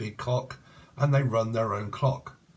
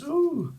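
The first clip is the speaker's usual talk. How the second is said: high, falling "ooh"; short high coo of mock surprise; teasing ooh. Real, not acted. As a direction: let out a pitched-up playful ooh. Teasing reaction to the card.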